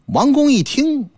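ordinary delivery, nothing marked

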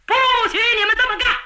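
high, commanding call from the doorway; ringing order to stop; loud interruption. A narrator's reading. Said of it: "门口有人高声道".

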